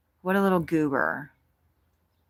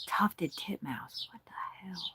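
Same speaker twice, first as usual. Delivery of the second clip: whispered disbelief; 3 semitones higher, 11 dB quieter than usual. Real, not acted. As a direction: hush it like you can't believe the name. Whispering bird name in disbelief.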